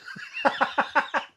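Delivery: breathy high-pitched laugh; airy high laugh. Real, not acted. Laughing at the punchline.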